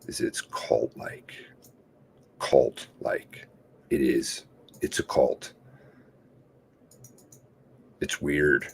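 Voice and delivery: hushed, breathy emphasis on "cult-like"; real whisper repeating "cult-like". Real, not acted.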